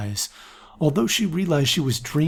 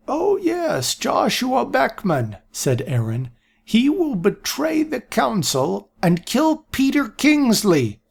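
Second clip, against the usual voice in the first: put-on much higher, slow prophetic old man; old seer voice; 11 semitones higher, slower than usual. A narrator's reading. Aaron predicting betrayal, high slow old voice. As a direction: pronounce doom like a confused oracle.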